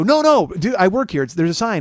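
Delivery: loud, high-pitched pleading; acted-out desperate objection; retold frantic protest. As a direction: cut in urgently to stop the ticket. Retold protest to parking officer.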